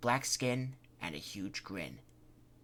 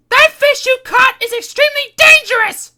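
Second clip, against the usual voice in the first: screaming warning; 20 dB louder than usual. Acted. Kitty warns screaming.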